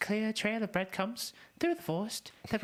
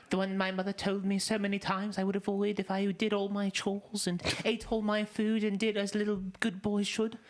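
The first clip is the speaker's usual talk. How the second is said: ordinary talk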